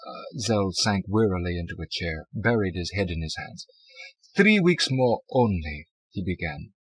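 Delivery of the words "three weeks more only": put-on German accent. A narrator's reading. German Zell, slow.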